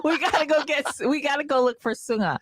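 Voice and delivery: high, rushed, half-laughing plea; frantic urgency. Retold or imitated. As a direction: urge them out the door now. Retelling frantic rush.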